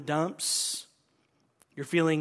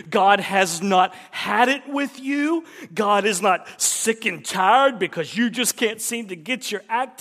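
much louder and higher, impassioned; preaching at full force; 4 semitones higher, 11 dB louder than usual. Real, not acted. Preacher shouting assurance to Christians. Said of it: "hear me loud and clear".

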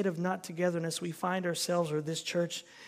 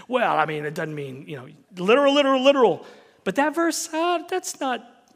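flustered backpedaling, climbing louder and higher; a nervous literalist wriggling out of it, louder on each literal; squirming literalist; 6 semitones higher, 9 dB louder than usual. Acted. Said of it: "You can watch them squirm".

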